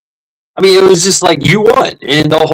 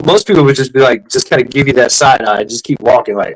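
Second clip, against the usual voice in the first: ordinary talk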